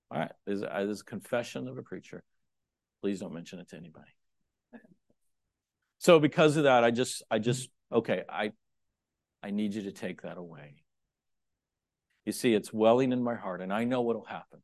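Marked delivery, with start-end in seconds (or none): 3.0-4.1 soft, quick joking aside; mock secret. Preacher to the congregation, soft quick joke. 9.4-10.8 soft, intimate spoken prayer; demo prayer. Shown as a demonstration. Preacher demonstrating his prayer to God, soft intimate. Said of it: "this way".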